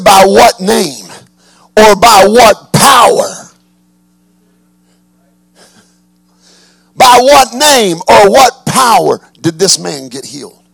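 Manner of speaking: slow, drawn-out, loud challenge; slow emphatic question. Acted. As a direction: lean on every word. Quoting accusers, then repeating.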